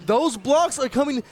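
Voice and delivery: slow booming hype call; hype shout. Real, not acted. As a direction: belt it out like a caster.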